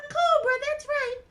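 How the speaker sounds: high puppet voice, counting off; Mimi. Acted. Mimi recounts the guests, high puppet voice. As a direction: recite as a tiny character.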